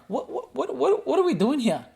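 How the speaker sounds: can't believe what he's hearing; incredulous outburst. Real, not acted. Incredulous rant about prime minister.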